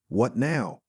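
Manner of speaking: ordinary delivery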